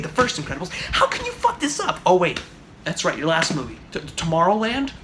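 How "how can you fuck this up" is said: exasperated disbelief. Real, not acted. Fan asking Brad Bird how he ruined it, exasperated high. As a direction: voice leaps much higher in disbelief.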